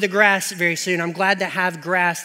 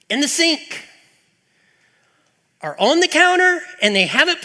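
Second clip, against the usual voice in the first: high, loud exasperated tirade about the dishes; exasperated rant; 9 semitones higher, 5 dB louder than usual. Acted. Preacher rants at family about dishes left out, exasperated and loud. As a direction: rant like a fed-up spouse at the sink.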